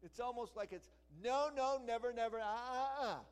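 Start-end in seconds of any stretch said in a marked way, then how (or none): 1.2-3.2 high, loud stammered nos; preacher shouting a triple negative; emphatic shout. Shown as a demonstration. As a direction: hammer the refusal home. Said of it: "It's a triple negative".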